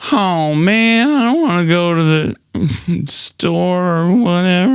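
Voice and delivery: high whiny complaint; mock whining, like a sulky kid. Shown as a demonstration. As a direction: whine like a kid who wants to stay. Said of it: "Just go".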